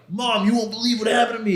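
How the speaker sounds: excited, high-pitched burst of news; on fire. Retold or imitated. Son telling Mom he's on fire, excited. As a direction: gush it out high, bursting to tell. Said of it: "I'm on fire now".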